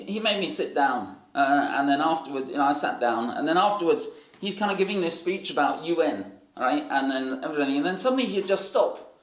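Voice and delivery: ordinary talk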